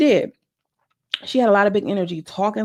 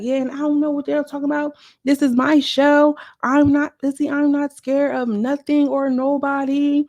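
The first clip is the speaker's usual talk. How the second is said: defiant mock declaration; 8 semitones higher than usual. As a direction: declare it's your show, head high.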